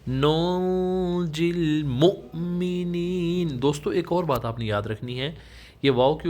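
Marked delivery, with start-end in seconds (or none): 0.1-3.5 نُنْجِي with the نون hidden in the nose, slow; nasal ikhfa. Shown as a demonstration. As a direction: hide the نون of نُنْجِي nasally while reciting. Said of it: "نون کی آواز کو ناک میں چھپائیں گے". (نُنْجِي میں نون کا اخفا)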